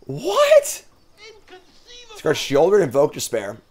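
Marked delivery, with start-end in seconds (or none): 0.1-0.9 sharp high "What?"; shocked disbelief; surprised. Real, not acted. Sees opponent discard key card.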